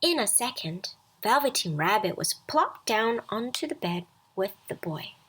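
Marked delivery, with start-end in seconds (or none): none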